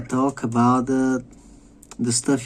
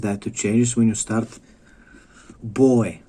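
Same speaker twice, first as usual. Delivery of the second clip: normal talking voice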